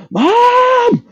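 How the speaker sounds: high, loud yelled Mom calling from bed; retold scream for mom. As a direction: shriek it out like a panicked kid calling his mother. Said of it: "I screamed like".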